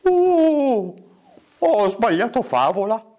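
high howl easing down into speech; howl, then realise the mistake; comic high wolf voice; wolf's apologetic howl. Acted. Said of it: "E il lupo rispose".

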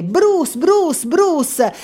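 high, repeated cheer of a name; crowd cheering. A narrator's reading. Team roots for the narrator on his streak, cheering. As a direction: chant it up high like fans. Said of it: "Facevano tutti il tifo per me".

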